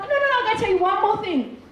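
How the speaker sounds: fast, high-pitched insistence, acted out; unstoppable eager convert. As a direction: cut in quickly, high and eager. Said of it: "you can't make them be quiet".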